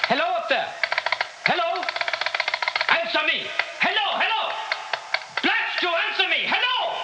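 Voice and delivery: building from calls to high panicked yells; trapped leader yelling into a dead line, panicking; frantic shouting. Acted. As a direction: scream up the line, growing desperate.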